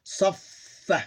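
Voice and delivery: "Saffa" with the f held long, then a short a; combined double consonant. Shown as a demonstration. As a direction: merge both f sounds in "Saffa". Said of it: "So we combine these two".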